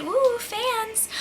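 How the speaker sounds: high, airy squeal; mock excitement. Mocking Zach's delight about fans, high airy coo. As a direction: coo it like a thrilled kid.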